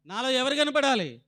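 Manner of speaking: preacher hammering the question again. Shown as a demonstration. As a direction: press the question home to the congregation. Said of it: "repeated question, second louder".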